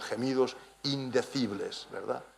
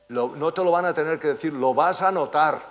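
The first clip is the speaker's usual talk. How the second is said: forceful preaching; 5 semitones higher, 10 dB louder than usual. Real, not acted. Preacher assuring the believer he will notice the change, forceful. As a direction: build to a loud, high cry.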